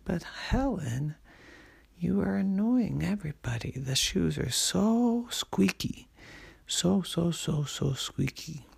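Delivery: put-on a fed-up mom scolding her child; annoyed mommy. Mommy scolds Helen in story.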